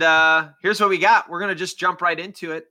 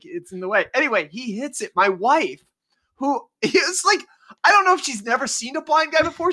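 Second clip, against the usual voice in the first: normal talking voice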